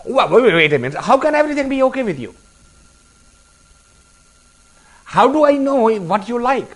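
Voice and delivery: high, fast, incredulous questioning; exasperated host. Retold or imitated. Host exasperated at guest who says everything is okay, high fast disbelief. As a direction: throw up your hands at the guest who likes everything.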